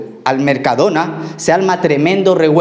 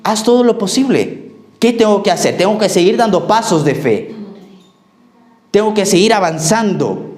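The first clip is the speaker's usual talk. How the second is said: rising, higher fervent exhortation; preaching; 4 semitones higher than usual. Acted. Pastor urging the church to seek God, fervent preaching. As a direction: urge the congregation on, pitched high.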